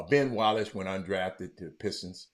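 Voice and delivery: ordinary delivery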